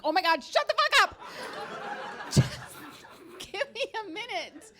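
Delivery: exasperated outburst. Retold or imitated. Exasperated mom snapping.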